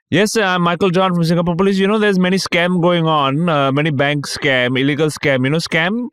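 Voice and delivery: put-on high-pitched Indian-accented phone scammer. Scam caller introduces himself as Singapore police, imitated Indian accent. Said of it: "it's a dude with a Indian accent".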